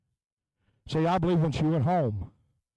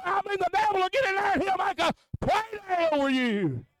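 higher, driving preacher's cadence; full-force preaching; 7 semitones higher than usual. Acted. Preacher warning the congregation about addiction, driving preaching. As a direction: push the warning home to the church.

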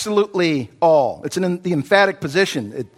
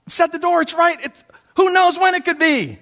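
urgent excitement; 9 semitones higher than usual. Shown as a demonstration. Urgent repeat, much higher.

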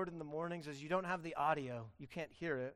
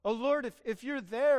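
raised, louder mock call into the void; hammed-up unsure prayer, higher and louder; vague searching call; 5 semitones higher, 8 dB louder than usual. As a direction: call out uncertainly to anyone listening. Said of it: "It's not a generic call like".

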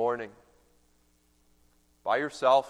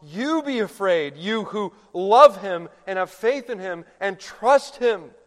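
higher and louder, ringing proclamation; angel's proclamation; 5 semitones higher, 5 dB louder than usual. Acted. Pastor voices the angel reassuring the mourners, a loud ringing proclamation. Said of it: "But the angel proclaims".

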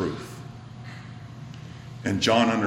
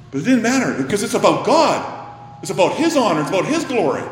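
loud emphatic preaching; 9 semitones higher, 6 dB louder, faster than usual. Real, not acted. Preacher booming to his congregation, loud emphatic. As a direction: raise the voice, preaching at full force.

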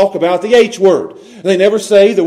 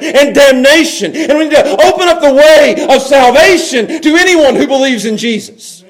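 loud, raised hellfire sermon voice; full-force revival preaching; fiery preaching; 5 semitones higher, 4 dB louder than usual. Acted. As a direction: preach it hard to the congregation.

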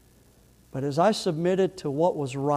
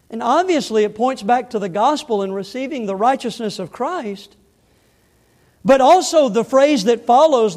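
raised, loud expository preaching; forceful preaching; 7 semitones higher, 9 dB louder than usual. Acted. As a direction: drive the verse's meaning home at high volume. Preaches the verse's meaning forcefully.

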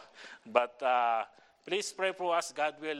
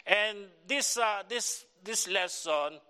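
preaching; 3 semitones higher than usual. Acted. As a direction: rouse the church with the message. Starts preaching the message.

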